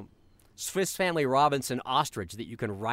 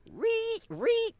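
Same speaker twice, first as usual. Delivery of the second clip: very high squeaky pig squeal. Shown as a demonstration. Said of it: "they might go".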